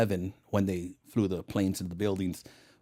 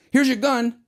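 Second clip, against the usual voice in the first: higher and louder cop voice; cops handing a gun; 13 semitones higher, 8 dB louder than usual. Retold or imitated. Brazilian police officer handing him a gun, higher and louder. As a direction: toss it off casually, loud and high.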